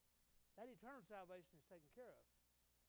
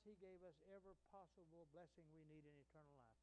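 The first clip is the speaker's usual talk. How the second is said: ordinary delivery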